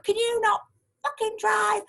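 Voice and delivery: high mock yell on "fucking drive"; imitating angry shout. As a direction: shriek it like the furious driver. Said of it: "this lady appeared at my window going".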